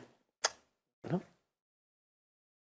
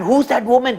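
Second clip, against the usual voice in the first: alarmed question; 9 semitones higher, 4 dB louder, faster than usual. Acted. Villagers in alarm.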